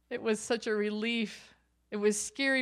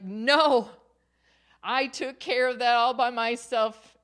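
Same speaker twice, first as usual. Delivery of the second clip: louder, higher, firm no; defiant refusal; 4 semitones higher, 7 dB louder than usual. Retold or imitated. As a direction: push him away with a hard no. Refusing husband's memorial offer.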